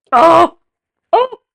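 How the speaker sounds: sudden, very loud high "Oh!"; startled exclamation. Real, not acted. Almost dropping the Lego bus, startled yelp. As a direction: yelp in surprise.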